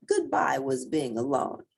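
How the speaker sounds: plain reading voice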